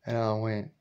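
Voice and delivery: normal talking voice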